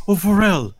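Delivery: put-on loud, high drunken plea; slurring, clingy drunk barmaid; drunk pleading woman.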